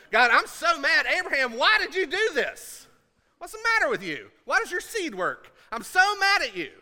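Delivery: high, loud whining tirade building to a yell; mock furious rant. Sarah complains to God about Hagar, growing furious. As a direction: rant like a jealous wife boiling over. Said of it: "Sarah gets pissed".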